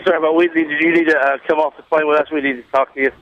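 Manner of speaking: ordinary delivery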